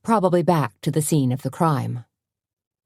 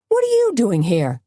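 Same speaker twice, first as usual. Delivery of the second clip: sharp, raised demand, pitched up; 7 semitones higher than usual. A narrator's reading. Reporter confronting rival on his turf, demanding. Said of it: "he demanded of Berger".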